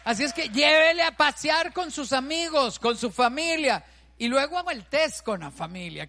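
shouted exhortation. Real, not acted. Preacher urging couples, shouted exhortation. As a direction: command them with full force.

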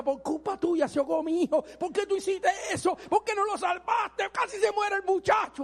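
mother berating the man who saved her son; furious scolding. Retold or imitated. Mother blaming the small man for her son nearly drowning, angry shout.

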